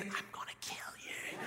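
whispered threat, imitating a crying sister; hushed, hammed-up sibling fury; mock tearful threat. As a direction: hiss it voicelessly, as if bawling. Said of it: "she's the only one there crying".

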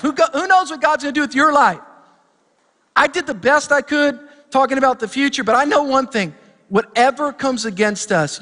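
high, loud, stretched-out sermon delivery; fired-up preaching. Acted. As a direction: preach it like the Spirit is moving. Pastor leads call and response.

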